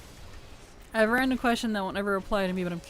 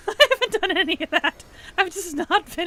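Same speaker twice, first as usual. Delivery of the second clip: laughing; 11 semitones higher, 7 dB louder than usual. Real, not acted. Laughs at own neglect.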